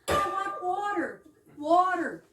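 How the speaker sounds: rising loud call for water; shouting. Acted. Woman calling out loudly for water from the pipes.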